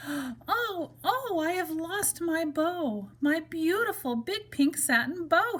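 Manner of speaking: high, slow wail over the lost bow. Acted. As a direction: cry it out like a heartbroken toy bunny. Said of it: "he wailed".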